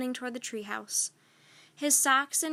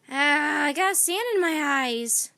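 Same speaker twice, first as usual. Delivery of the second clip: louder complaining whine; pirate whining about grit in his eyes; whined; 3 semitones higher, 7 dB louder than usual. A narrator's reading. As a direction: moan about the sand, louder. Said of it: "Pinky whined".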